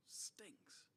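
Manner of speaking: hissed disgust, almost no voice; a sour whispered aside on the unfair party; whispered sneer. Acted. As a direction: breathe it out in disgust.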